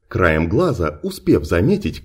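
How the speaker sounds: ordinary delivery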